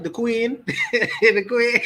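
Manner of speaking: The Queen said through real laughter; amused, laughing at the idea of the Queen. Real, not acted. Mocking countrymen's loyalty, laughing.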